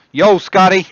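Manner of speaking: loud, high-pitched holler; calling out. Real, not acted. Streamer hailing Scotty as he turns up, calling out loudly. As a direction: shout a greeting across to someone.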